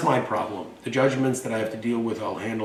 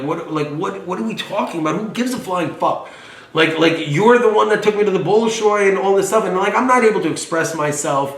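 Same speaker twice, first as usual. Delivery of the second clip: higher and louder, building exasperated rant; heated argument; 8 semitones higher, 8 dB louder than usual. Retold or imitated. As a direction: relive the fight with mom, voice climbing. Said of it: "we got into a huge fight".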